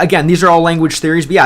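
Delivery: ordinary talk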